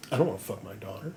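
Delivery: muttered denial. Retold or imitated. King in denial.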